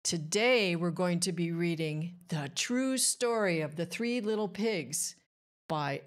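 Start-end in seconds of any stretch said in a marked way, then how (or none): none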